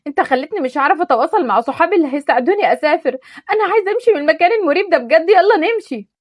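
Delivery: put-on furious girl throwing him out; angry outburst. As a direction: lash out at him in a rage. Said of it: "فانفعلت عليه بغضب".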